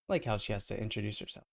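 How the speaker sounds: usual speaking voice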